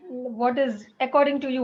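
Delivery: normal talking voice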